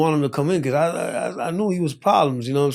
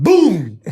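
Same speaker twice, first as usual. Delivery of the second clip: loud, higher "boom"; imitated punch impact; 4 semitones higher, 7 dB louder than usual. Telling how he got punched hard, imitated impact. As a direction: burst out the blow's sound.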